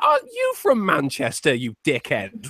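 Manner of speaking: indignant retold retort; incredulous comeback. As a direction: throw the question back, drawn out. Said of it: "And I went".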